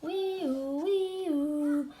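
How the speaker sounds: high wailing siren; siren sound. Acted. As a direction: wail it like a siren. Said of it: "Sonó una sirena".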